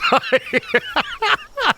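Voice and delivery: high-pitched villain cackle; shrieking evil laugh. Acted. After looting pal's bones.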